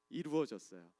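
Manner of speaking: usual speaking voice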